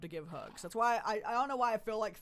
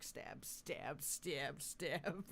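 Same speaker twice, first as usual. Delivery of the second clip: voicing a cat; 3 semitones lower, 7 dB quieter, much slower than usual. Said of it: "they're just like".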